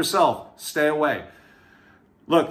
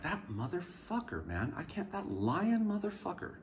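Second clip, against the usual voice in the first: much softer, cursing under his breath; muttered curse; 3 semitones higher, 13 dB quieter than usual. Retold or imitated. In the holding area, muttering about the lying cop. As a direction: mutter the curse half to yourself. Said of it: "I'm even speaking out loud at this point".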